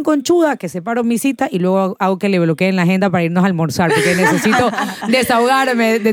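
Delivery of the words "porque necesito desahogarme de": laughing. Real, not acted. Laughs while telling.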